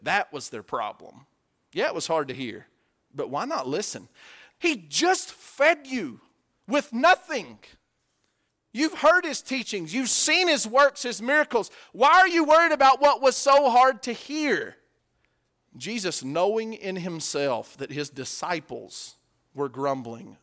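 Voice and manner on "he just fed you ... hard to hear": loud, high, emphatic preaching; full-force preaching. Real, not acted. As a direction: drive it home from the pulpit.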